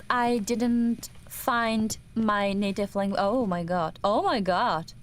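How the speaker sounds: ordinary talk, nothing marked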